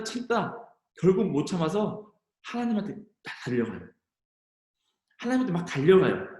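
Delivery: ordinary talk, nothing marked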